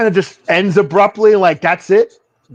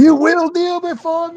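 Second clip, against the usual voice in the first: high commanding villain voice; menacing Zod command; dark lord; 12 semitones higher than usual. Retold or imitated. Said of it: "the General Zod sample".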